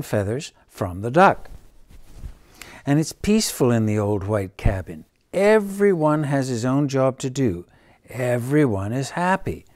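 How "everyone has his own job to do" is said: plain reading voice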